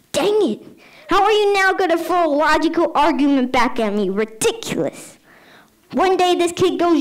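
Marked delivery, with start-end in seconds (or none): none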